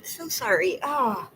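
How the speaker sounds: high-pitched, crying apology; tearful apology. Real, not acted. Apologizing to viewers after breaking down, tearful. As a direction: apologize through real tears. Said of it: "but I cried".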